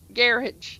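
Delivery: garage as GARR-ij, stress first; "garage" with a short -ij ending; Irish-style garage. Shown as a demonstration. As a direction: say garage to rhyme with carriage. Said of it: "so then that's when I'm, like, kinda like".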